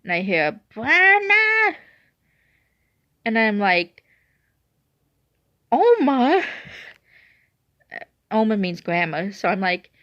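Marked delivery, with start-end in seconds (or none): 0.7-1.8 very high, loud singsong call; cute little voice. Retold or imitated. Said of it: "I hear this cute little voice". 5.7-6.4 high, loud cry of disbelief; astonished calling out. Retold or imitated. Calls to grandma in shock.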